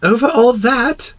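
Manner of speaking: high, slow rising question; high, wondering child prince; amazed little prince. A narrator's reading. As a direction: ask in awe as a small boy.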